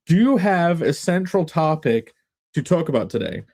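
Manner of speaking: ordinary delivery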